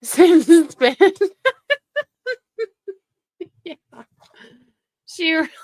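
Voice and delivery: words breaking into high, loud laughter; laughing. Real, not acted. Reacting to the clip, laughing out loud.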